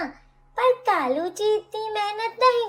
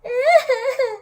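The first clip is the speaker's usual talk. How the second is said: sudden loud, higher wail of crying; loud sob; 4 semitones higher, 4 dB louder than usual. Acted. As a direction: burst into a cartoon sob. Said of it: "तुमने तो मेरी बेटी को रुला ही दिया".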